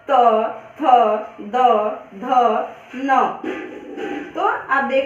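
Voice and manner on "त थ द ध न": त, थ, द, ध, न each said with the tongue on the teeth, then a pause; teacher showing the dental letters; letter demonstration. Shown as a demonstration. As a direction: sound out त थ द ध न clearly. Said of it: "जब आप उच्चारित करेंगे".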